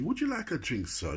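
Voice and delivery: high posh waiter voice; polite, lifted posh offer; posh butler. Retold or imitated. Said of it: "he talked quite posh".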